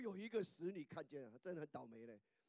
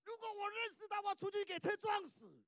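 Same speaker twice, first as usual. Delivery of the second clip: high, loud self-curse; shouted curse; 9 dB louder than usual. Retold or imitated. Preacher shouts Peter's self-curse, high and loud.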